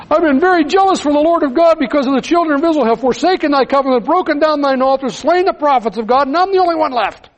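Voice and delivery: high, fast, pleading lament; complaining prophet. Acted. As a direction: rush the grievance out, high and aggrieved. Elijah repeats his complaint.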